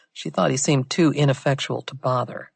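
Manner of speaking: usual speaking voice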